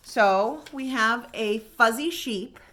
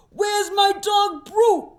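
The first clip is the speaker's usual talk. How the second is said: slow, much higher yell; 9 semitones higher, 4 dB louder, slower than usual. Acted. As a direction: call out for the lost dog. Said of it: "yelled Ratso".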